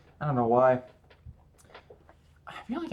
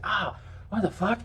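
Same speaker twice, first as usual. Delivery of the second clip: pitched-up startled yelp at a sudden wrist pain; mock pained outburst; 9 semitones higher than usual. Telling viewers about the gym injury, mock yelp. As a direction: replay the shock of the wrist giving out. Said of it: "I think I was like".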